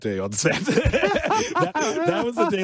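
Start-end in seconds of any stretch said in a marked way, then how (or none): 0.6-2.6 high laughing burst into words; giggling as the memory hits. Real, not acted. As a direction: laugh through the start of the sentence. Laughing while recalling shoot day.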